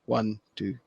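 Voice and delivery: hushed little imitation of counting; quiet mimed count. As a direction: count it off softly, imitating the wrestler. Mimics Kane's small finger counting.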